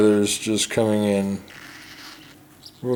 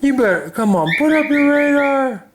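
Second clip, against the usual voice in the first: put-on high, louder coaxing baby-talk voice; 10 semitones higher, 4 dB louder than usual. Owner urging the chick to perk up, in high baby talk. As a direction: coax the chick like a doting parent.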